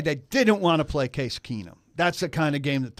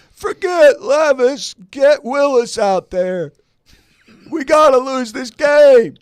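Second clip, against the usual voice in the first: higher, louder, slow fan rant; mock fan yell; 11 semitones higher, 11 dB louder, slower than usual. Fans yelling demand mockingly.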